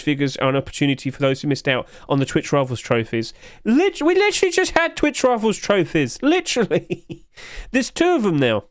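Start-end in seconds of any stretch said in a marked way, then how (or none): 3.6-8.6 high-pitched incredulous squeal; mock exasperation. Streamer answers viewer who missed the trophies, high mock exasperation. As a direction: protest in a high, hammed-up voice.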